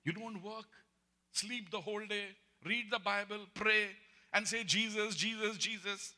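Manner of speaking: high, loud sneering sister; mock him in a high jeering voice. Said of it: "She said that".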